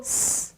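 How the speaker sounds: स as a bare hissed consonant, no vowel; half s. Shown as a demonstration. Teacher isolates the first sound for learners, स as pure "sss". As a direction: hold स as "sss" alone. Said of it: "ये आधा स है".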